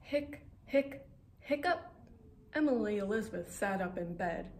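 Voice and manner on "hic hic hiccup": hiccups. Acted. Dog hiccuping sound in story.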